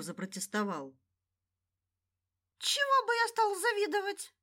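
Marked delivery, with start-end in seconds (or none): 2.6-4.3 high put-on protesting voice; squeaky indignant protest; protesting dwarf voice. Gnur protests in character voice.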